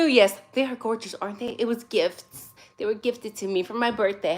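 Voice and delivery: usual speaking voice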